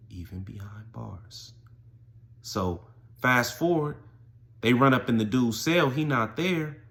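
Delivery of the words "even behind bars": very soft, slow punchline; hushed refrain. Real, not acted. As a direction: let it sink to a slow hush. Refrain dropped to a hush.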